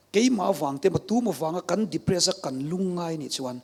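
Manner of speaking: ordinary talk, nothing marked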